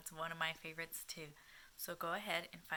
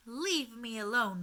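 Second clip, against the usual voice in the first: shouted; 4 semitones higher, 8 dB louder than usual. Acted. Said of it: "the old woman shouted".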